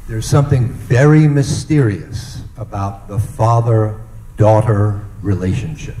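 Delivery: normal talking voice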